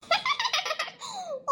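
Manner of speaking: a high, amused laugh at the teasing; high giggling laugh; high burst of laughter. Acted.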